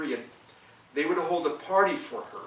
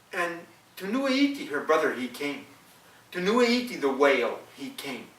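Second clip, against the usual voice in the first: ordinary delivery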